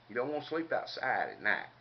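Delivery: ordinary talk, nothing marked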